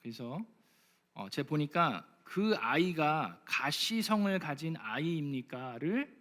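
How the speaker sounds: ordinary delivery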